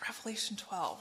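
whisper. Acted. Whispered chapter reference.

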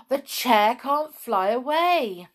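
thrilled, drawn-out discovery; excited realisation. A narrator's reading. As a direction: announce it with dawning delight. Molly realises the gnomes are stuck.

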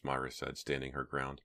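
plain reading voice

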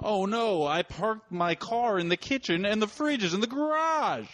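high, panicky sitcom line; high excited panic. Acted. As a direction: blurt it out in a panic. Improv wacky line.

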